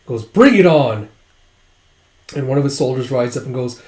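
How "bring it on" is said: loud, slightly raised battle taunt; retold fighter's dare; acted challenge. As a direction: call out a defiant challenge.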